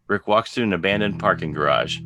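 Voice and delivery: ordinary talk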